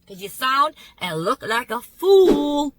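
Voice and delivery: slow, loud, spelled-out scolding; slow emphatic put-down. Retold or imitated. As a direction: drag it out, scolding like a preacher.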